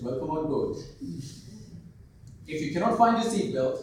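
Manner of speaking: cheerful, laughing cabin announcement; a flight attendant joking over the intercom; funny flight announcement. Retold or imitated. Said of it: "imagine the same announcement, something like this".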